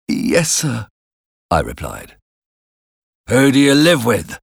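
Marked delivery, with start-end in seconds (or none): 0.1-0.9 put-on timid, higher child's voice; reply meekly in a small voice; frightened boy. A narrator's reading. 3.3-4.4 raised, gruff demand; menacing convict. A narrator's reading. Convict questions the boy.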